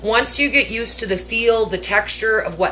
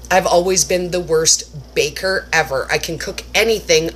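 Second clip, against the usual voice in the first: usual speaking voice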